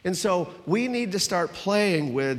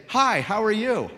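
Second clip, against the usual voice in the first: higher, louder, slow model greeting; hearty greeting; 7 semitones higher, 5 dB louder than usual. Shown as a demonstration. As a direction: greet a neighbour big and clear for all to copy.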